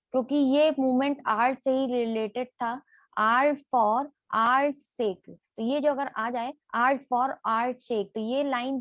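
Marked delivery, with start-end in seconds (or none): 3.2-5.3 slow emphasis. Shown as a demonstration. Teacher repeating key phrase.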